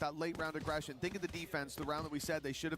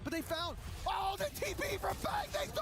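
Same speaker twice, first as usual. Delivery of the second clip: much higher, building excited call; esports caster; 11 semitones higher than usual. Real, not acted. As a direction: build the hype as the play unfolds. Surprise teleport play, caster builds.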